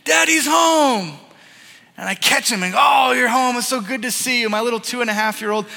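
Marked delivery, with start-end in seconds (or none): 0.0-1.2 put-on high, loud, drawn-out child's yell; excited child's shout. Six-year-old son leaping at dad, joyful mock shout. 2.8-4.5 loud, high, gushing welcome that settles; delighted dad greeting. Retold or imitated. Dad catching his little son, acted delighted shout.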